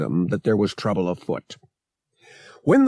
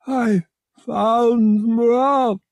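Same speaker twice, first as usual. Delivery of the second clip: high, slow alien voice; the giant alien speaks high and slow; Murg's alien voice; 12 semitones higher, 5 dB louder, much slower than usual. A narrator's reading. Murg tells Han his news in a high, slow alien voice. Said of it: "Murg said".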